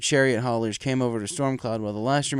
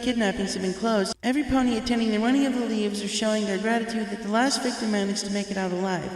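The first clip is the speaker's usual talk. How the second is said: put-on baby dragon announcer; 9 semitones higher than usual. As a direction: welcome the whole crowd like a young dragon. Spike commentating the race.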